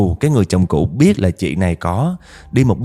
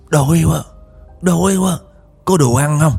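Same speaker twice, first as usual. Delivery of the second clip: much higher pleading voice; hungry spirit voice; 8 semitones higher than usual. Retold or imitated. Possessed woman begging relatives for food, high and pleading. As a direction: beg for food like a starving ghost.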